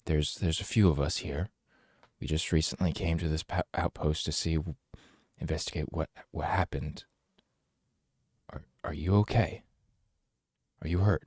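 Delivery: soft, quiet and calm; gentle reassuring. Acted. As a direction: soothe a scared stranger. Calming a frightened woman.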